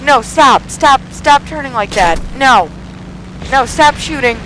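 high-pitched frantic refusals; panicked protest. Real, not acted. Gamer dodging a plane's gunfire, panicked protest. Pleading with attacker while dodging fire.

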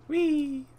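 very high, drawn-out whee; playful whee. Real, not acted. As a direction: squeal a long, piping whee. Playful cry while flying in game.

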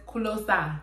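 "Kulo Sai" as a Nigerian-accented "closer", open A ending; Nigerian-accented "Kulo Sai", no final R. Shown as a demonstration. Showing how the singer says closer, Nigerian accent. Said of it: "says it in a Nigerian accent".

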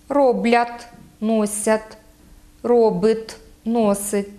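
Роблять, носять, робить, носить ending in hard t; hard final т. Shown as a demonstration. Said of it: "вимовляється твердо".

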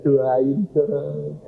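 drawn-out, wheedling request; pleading. Retold or imitated. Sleepless wife nudging husband awake for prayer, pleading. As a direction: plead with the last word stretched.

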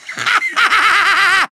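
self-satisfied villain laugh; gloating staccato laughter; evil cackle. Acted. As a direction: cackle at your own greatness. Villain gloats over his own awesomeness.

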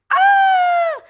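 high shriek of fright; frightened scream. Acted. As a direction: scream in a panic. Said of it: "gritó".

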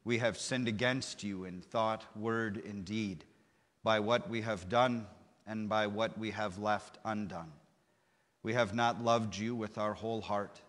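ordinary delivery

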